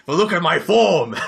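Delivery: ogreish. Acted. Ogre boasting to the party about his strength, lightly ogreish. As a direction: gloat about his form like a mild ogre. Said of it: "he sounds a little bit ogreish".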